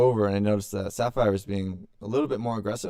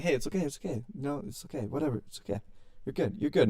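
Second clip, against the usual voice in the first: soft, higher-pitched comforting patter; gentle retold pet-calming voice; soothing the dog; 5 semitones higher, 5 dB quieter, faster than usual. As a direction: hush a hurt pet with repeated reassurance.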